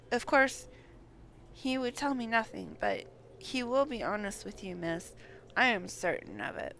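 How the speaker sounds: normal talking voice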